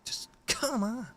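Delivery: high, soft, breath-only whine; exasperated plea. Real, not acted. Host despairing at pinball prices, exasperated whispered plea.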